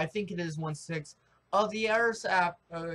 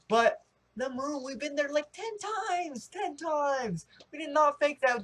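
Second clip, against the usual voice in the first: worked up; 8 semitones higher than usual. Real, not acted. Ranting about the moon landings.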